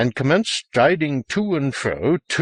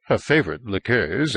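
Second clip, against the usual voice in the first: usual speaking voice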